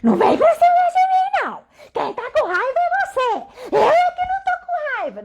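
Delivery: very high, angry yell, hammed-up; raiva encenada. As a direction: act out snapping back at someone in anger. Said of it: "ficar com raiva igual a pessoa". (grito de raiva encenado, bem agudo)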